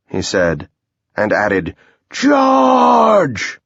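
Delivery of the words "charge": high, long-held shout; battle cry. Acted. Commander sending troops down the hill, battle cry.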